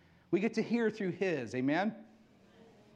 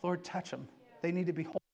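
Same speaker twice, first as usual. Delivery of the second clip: soft prayer; 5 semitones lower, 5 dB quieter, faster than usual. Retold or imitated. Praying for those who hurt.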